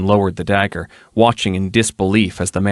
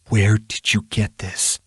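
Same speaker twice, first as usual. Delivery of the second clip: rasped; 3 dB quieter than usual. Acted. Erilyn rasps at the captive over the snuff box. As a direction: rasp the question out tightly. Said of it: "she rasped".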